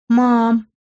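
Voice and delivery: small drawn-out whimper. A narrator's reading. Daughter calling her mother on the phone, whimpering. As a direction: whine it on one held note. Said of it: "проскулила я".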